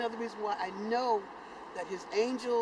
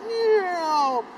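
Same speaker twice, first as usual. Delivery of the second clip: mock meow; 11 semitones higher, 10 dB louder than usual. Imitated meow while landing.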